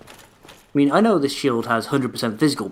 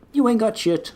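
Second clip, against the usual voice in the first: taunting; 9 semitones higher than usual. Real, not acted. Taunt repeated higher.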